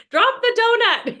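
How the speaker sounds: high, loud mock order; mock scold. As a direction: bark it like a trainer catching a snack. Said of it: "in everybody's home saying".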